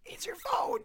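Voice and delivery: urgent whispered plea. Real, not acted. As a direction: hiss it under your breath. Whispering at ringing phone.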